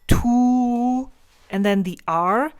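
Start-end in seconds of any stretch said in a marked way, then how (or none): 0.1-1.1 "tu" with a long oo, high and loud. Shown as a demonstration. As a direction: stretch the oo in tu. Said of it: "So it's more like a long oo".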